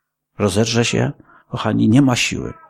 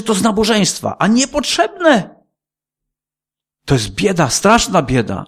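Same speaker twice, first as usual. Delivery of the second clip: fiery preaching; 10 semitones higher than usual. Acted. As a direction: preach it hard, lingering on niepotrzebne. Preaching at full force.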